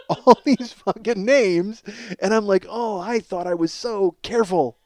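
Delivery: ordinary talk, nothing marked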